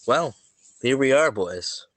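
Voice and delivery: ordinary talk, nothing marked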